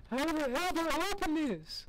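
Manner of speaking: very high, strained comic whine; exasperated squeal. Real, not acted. Gamer stuck at a door, exasperated squeal. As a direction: squeal the question way up high in frustration.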